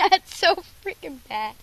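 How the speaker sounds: laughing. Real, not acted. Tasting sour candy, laughing.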